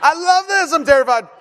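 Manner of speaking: loud, high shout, dropping a little; imitated thrilled terror. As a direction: yell like a kid both delighted and scared. Scared yet thrilled son voiced.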